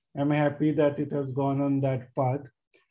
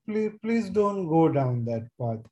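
usual speaking voice

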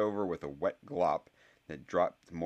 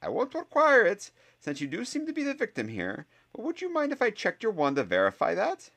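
much higher, dry and reproachful; a sceptical teacher, higher and clipped; stern professor; 8 dB louder than usual. Acted. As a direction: scold the boy with crisp authority. Professor Sprout voiced by narrator.

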